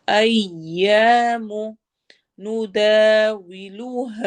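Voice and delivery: slow melodic tajweed chant; measured Quranic recitation. Shown as a demonstration. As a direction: recite slowly, stretching the long vowels. Recites verse phrase.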